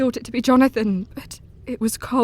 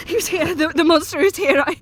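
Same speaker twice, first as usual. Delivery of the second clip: higher, panicked with a nervous laugh; frightened; 6 semitones higher than usual. Acted. Mina waking in terror after the monster's visit. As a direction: stammer out the terror. Terrified wake from attack.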